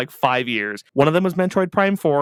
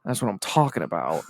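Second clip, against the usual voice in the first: Frank's line; 7 semitones lower, 4 dB quieter than usual. Retold or imitated. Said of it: "And he goes".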